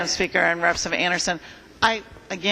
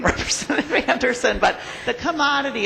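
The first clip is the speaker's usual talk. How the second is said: laughing through the words; a sheepish laugh over the name; 7 semitones higher than usual. Real, not acted. Legislator laughing at her own farming ignorance, sheepish laugh. Admits knowing little about farming.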